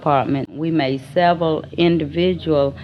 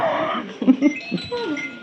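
high, soft laugh; 13 semitones higher, 3 dB quieter than usual. Real, not acted. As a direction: let out a real high-pitched laugh. Amused at frightened supervisor memory.

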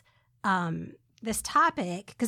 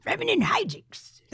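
pirate voice; 6 semitones higher than usual. Pretend. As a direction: growl it like a hammy pirate. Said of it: "Talk Like a Pirate Day".

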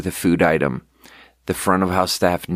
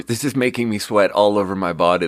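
ordinary talk

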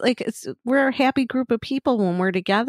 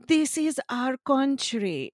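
slow, higher-pitched mock foreign accent; Melania; 5 semitones higher, 3 dB quieter, slower than usual. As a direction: imitate her heavy accent, drawn out. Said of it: "And Melania, every time".

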